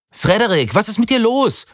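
high, frightened cry; startled shriek. A narrator's reading. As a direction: cry out in shock. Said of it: "kreischte Sarah erschrocken".

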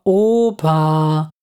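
drawn-out collective-style sigh; long, weary reproachful groan; exasperated sigh. A narrator's reading. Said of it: "alle seufzten im Chor".

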